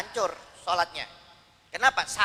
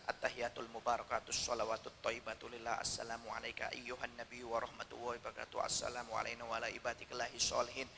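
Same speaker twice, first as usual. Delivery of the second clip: much softer and lower, murmured prayer; barely audible recitation; 8 semitones lower, 16 dB quieter than usual. Shown as a demonstration. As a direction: murmur the prayer so only you can hear it. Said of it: "Didengar oleh telinganya sendiri". (bacaan lirih hampir tak terdengar)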